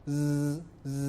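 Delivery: a buzzing, whistling "Z" for zai, then a plain th-like "z" for zal; showing the whistle quality of zai; whistled z. Shown as a demonstration. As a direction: hiss the first z and soften the second. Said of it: "sifat sofir".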